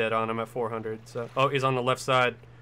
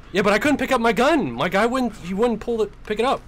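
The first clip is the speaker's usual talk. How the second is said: frustrated; 9 semitones higher, 6 dB louder than usual. Real, not acted. Complains gun wouldn't come out.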